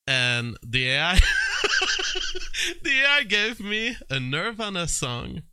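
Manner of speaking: words breaking into laughter; laughing while talking. Real, not acted. Laughing while telling AI story.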